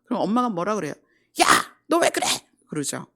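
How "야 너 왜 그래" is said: sharp, high, loud "why are you like that"; scolding mother. Retold or imitated. Mother scolding her child over the broken vase, sharp and loud. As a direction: snap at the kid like a startled mother.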